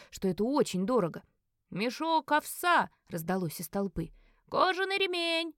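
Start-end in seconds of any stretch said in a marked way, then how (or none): 1.7-2.9 slow, raised-pitch call from a crowd; mildly voiced auction shout; calling out a bid. A narrator's reading. 4.5-5.5 high, slow bidder's call; drawn-out, mildly shouted bid. A narrator's reading. Second bidder calls from crowd.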